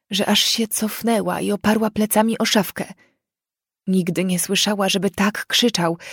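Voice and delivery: ordinary delivery, nothing marked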